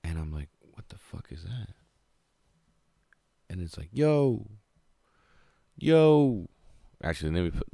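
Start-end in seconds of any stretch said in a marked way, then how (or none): 0.6-1.7 hushed, breathless whisper; startled whisper. Retold or imitated. Alone in the bus, whispering at a strange voice. 3.9-6.5 high, loud call of yo; mystery voice calling. Retold or imitated. A voice in his head calling out to him, loud and high. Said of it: "I hear a voice".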